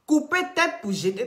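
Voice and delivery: high, loud "Couper tête pour jeter" flung at her; mock outraged call-out. Real, not acted. Live host calling out Sylvia, high and loud. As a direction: demand answers, pitch up and loud.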